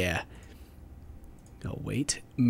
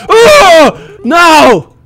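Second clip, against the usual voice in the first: high, loud, drawn-out wail; frustrated cry; 12 semitones higher, 14 dB louder than usual. Real, not acted. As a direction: cry out at the missed shot. Misses the birds.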